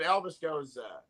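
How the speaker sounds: ordinary delivery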